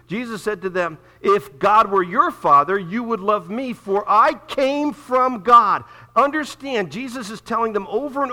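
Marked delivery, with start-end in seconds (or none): 1.2-5.8 loud, slow, weighty scripture reading; preaching at full force. Acted. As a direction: proclaim each word slowly. Preacher reads Jesus' words forcefully.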